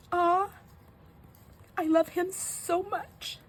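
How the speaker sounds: very high, slow, adoring squeak; toddler. Retold or imitated. Toddler loving the nice ghost, high imitated baby voice. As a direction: gush in a tiny child's voice. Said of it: "That's what the baby said".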